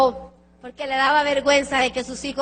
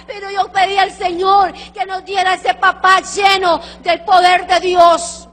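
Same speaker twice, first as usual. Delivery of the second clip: son's plea, proclaimed; 4 semitones higher, 8 dB louder than usual. Retold or imitated. Son's words retold at full preaching force.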